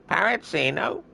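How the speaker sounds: very high squawking parrot. Retold or imitated. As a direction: screech it like a parrot. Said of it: "Doing an impression of a parrot".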